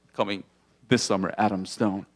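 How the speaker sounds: low, dramatic movie-trailer voice; deep trailer voiceover; trailer announcer. Pretend.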